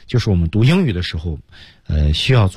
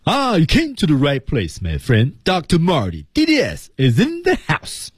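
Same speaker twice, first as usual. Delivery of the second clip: high-pitched, proud announcer flourish; one connected showy whole; 8 semitones higher than usual. Shown as a demonstration. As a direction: run both sentences together as one grand high-pitched entrance. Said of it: "把两句连起来，找一下它的整体感". (高调连贯、得意登场地说)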